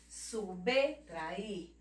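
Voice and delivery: subtrair as "su-bê, tra-ir"; "Subtrair" divided after bê; consonant only. Shown as a demonstration. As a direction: say the B of Subtrair by its letter name. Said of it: "você fala só a consoante".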